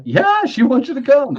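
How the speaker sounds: mock excited. Rookie telling his boss the customer wants him over, high giddy excitement. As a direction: gush it like he just won.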